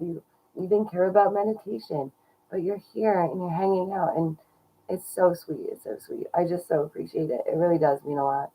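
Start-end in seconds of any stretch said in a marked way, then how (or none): 5.7-6.3 soft unvoiced whisper; whispered aside to the chat. Real, not acted. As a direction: breathe it without voice. Repeats sweet line, then whispers.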